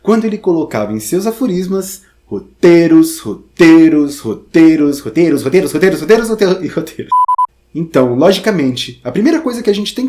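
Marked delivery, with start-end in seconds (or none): 2.2-7.1 loud hammered roteiros over and over; emphatic repetition. Acted. Teacher quoting Oswald de Andrade, loud insistent repetition. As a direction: pound the word roteiros each time.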